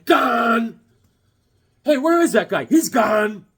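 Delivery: loud, high joking shout; mock hollering. Joking on a villain's name, loud mock shout. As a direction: holler it for comic effect.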